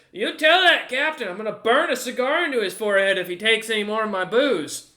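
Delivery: high, loud threat from the cook; angry cook. Acted. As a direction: send a furious warning to the captain. Cook threatens over his booze.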